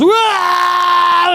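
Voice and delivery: hammed-up childish scream; imitated girls' scream. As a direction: squeal like scared kids. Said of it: "The girls are screaming".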